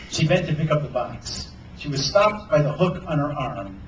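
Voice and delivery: usual speaking voice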